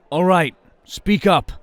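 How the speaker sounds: loud demand. Acted. Tired partner telling his friend to speak up, loud. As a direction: push him to talk.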